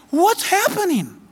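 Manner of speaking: lightly voiced confused bystander; imitating bystanders. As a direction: play a baffled onlooker staring at the red sun. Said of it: "That's what they said".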